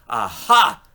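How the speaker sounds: loud sudden aha; aha moment. Acted. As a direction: exclaim the discovery. Minnie realises.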